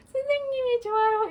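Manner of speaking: put-on high, loud little child's voice; cute child. Teacher imitating her cute pupil's affection, high childlike voice. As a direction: coo it like a sweet pupil. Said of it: "이렇게 하는 아이예요".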